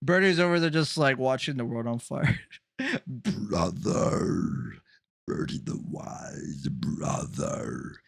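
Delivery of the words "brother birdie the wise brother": very low, drawn-out "brother"; slow, low, hushed put-on voice; deep mock-sage voice. Puts on voice for Birdie.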